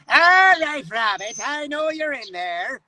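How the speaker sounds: hammed-up singsong Irish accent for a cartoon. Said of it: "you get the stereotypical Irish".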